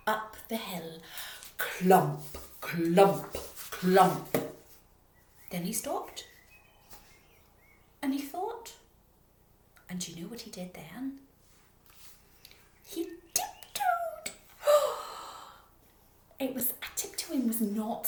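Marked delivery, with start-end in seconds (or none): 1.6-4.4 heavy stomping clump. A narrator's reading. Heavy footsteps. 12.8-16.4 high playful. A narrator's reading. Tiptoeing delight.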